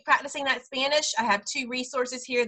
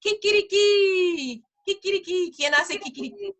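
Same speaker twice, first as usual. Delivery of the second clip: rooster crow imitation; 8 semitones higher than usual. Shown as a demonstration. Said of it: "what's another one".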